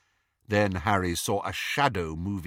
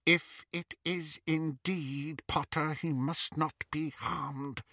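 slow, soft, higher musing; muttering; 6 semitones higher, 7 dB quieter, slower than usual. A narrator's reading. Bellatrix thinking aloud over the prisoners, muttering softly. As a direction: mutter slowly, more to yourself. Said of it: "she muttered".

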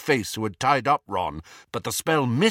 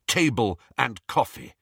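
plain reading voice

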